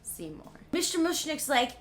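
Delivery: usual speaking voice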